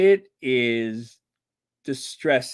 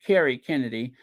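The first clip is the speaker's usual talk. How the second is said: normal talking voice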